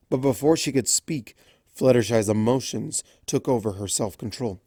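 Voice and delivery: usual speaking voice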